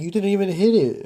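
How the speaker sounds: high, loud teasing disbelief; mocking friend. Teenage friends laughing at the kid's failed hit, loud teasing. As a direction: needle him for chickening out. Friends mock his cough.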